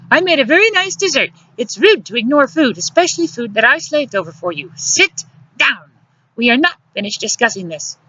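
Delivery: high-pitched bossy woman; indignant scolding. A narrator's reading. Luciana orders him to sit down, indignant scolding.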